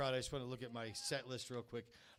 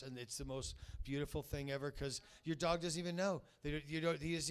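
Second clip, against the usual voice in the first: normal talking voice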